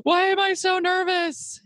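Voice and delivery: high, slow, shouted panic; panicked outcry. Retold or imitated. Realizing the mic was on, high panicked shout. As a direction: cry out in alarm at your own nerves.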